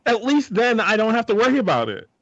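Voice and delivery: a worker losing patience with a thief, half laughing; mock exasperated employee. Employee tells a thief to steal later, mock exasperated. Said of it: "You're like".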